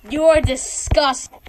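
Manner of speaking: loud, slow scold. Pretend. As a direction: tell them off slowly. Teacher scolds pupil.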